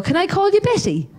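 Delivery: put-on high, sweet little old lady asking; a touch of a frail old woman; old woman's voice. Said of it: "she'd say to me".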